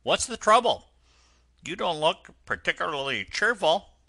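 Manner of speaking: high, loud friendly concern; kindly engine driver. Acted. Driver asking the sad washerwoman what's wrong, kindly. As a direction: ask kindly like a burly driver.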